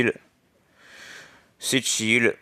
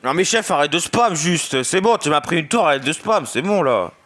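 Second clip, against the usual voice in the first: high, loud gaming rage; raging gamer; 5 semitones higher, 3 dB louder than usual. Real, not acted. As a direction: rant at the spamming opponent. Raging at opponent spamming.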